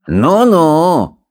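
high, long-drawn mocking drawl; slow, swooping taunt; drawling sneer. A narrator's reading. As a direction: stretch it out mockingly. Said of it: "протянул Сопляк".

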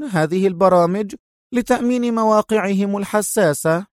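high-pitched, sceptical reasoning; high, insistent questioning; female analyst. A narrator's reading.

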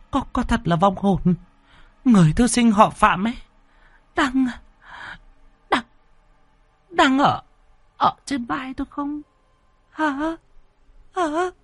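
very high, halting, stuttering voice; panic-stricken. A narrator's reading. Woman asking if the scholar's ghost sits on her, panic-stricken. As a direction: stammer the question in terror, breaking it into fragments. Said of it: "Mụ béo bây giờ đã hoảng loạn".

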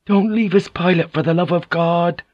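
high-pitched desperate plea; crying out. A narrator's reading. Frightened prisoner begging the pilot to stay, crying out. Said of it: "Croak cried out".

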